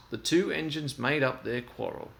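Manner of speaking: ordinary delivery, nothing marked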